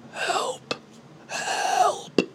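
tiny strangled whisper for help; breathy high whisper. Pretend. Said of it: "They're like".

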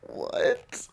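high, breathy disbelieving what; incredulous squeak. Real, not acted. Reacting to the president's tweet with an incredulous squeak.

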